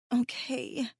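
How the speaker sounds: soft, sniffly and whiny. Acted. Mahdi agrees to Hassan in a sniffly, whiny voice. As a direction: give in with a small teary whine. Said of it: "in a sniffly, whiny voice".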